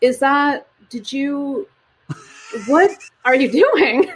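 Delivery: halting, baffled question ending in a laugh; mock bewilderment. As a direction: act out amused, stunned disbelief. Acts out reaction to piggyback races.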